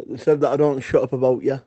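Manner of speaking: ordinary talk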